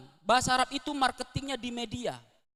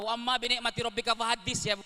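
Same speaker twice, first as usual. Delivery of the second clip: ordinary talk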